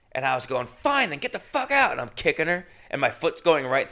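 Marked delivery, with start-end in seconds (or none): 0.7-1.9 retold scream. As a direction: yell it high, holding back the volume. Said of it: "I'm screaming".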